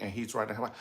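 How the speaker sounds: ordinary delivery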